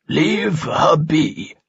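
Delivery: slow, drawn-out firm command; the old sage orders the spirit away from Kalia. A narrator's reading. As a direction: lay down the law slowly like an old sage. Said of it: "Falsafel commanded".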